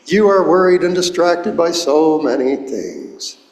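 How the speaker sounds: gently chiding in a mild Irish brogue; lightly imitating an Irish priest's brogue. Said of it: "he would say in his Irish brogue".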